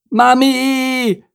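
high, held cry for mother; screaming. A narrator's reading. Bodo flung from the racetrack, screaming. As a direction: wail it out in a very high voice. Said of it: "schrie Bodo".

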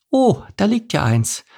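high voice of sudden discovery; high elderly exclamation; old mouse lady. A narrator's reading. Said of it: "sagte Marina Mausepups plötzlich".

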